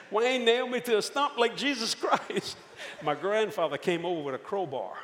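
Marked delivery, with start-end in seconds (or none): 0.0-2.6 put-on childlike whine. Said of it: "And I said".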